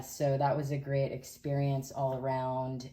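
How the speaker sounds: ordinary delivery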